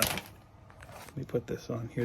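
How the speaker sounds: slow whispered aside. Real, not acted. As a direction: breathe it out under your breath. Muttered while fiddling with costume.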